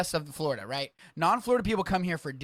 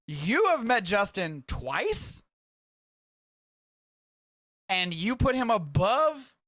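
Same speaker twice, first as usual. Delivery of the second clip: higher, slow mock outrage; mock offended; 5 semitones higher, 3 dB louder, much slower than usual. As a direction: drag it out like a wounded friend. Friend ranks Justin above him.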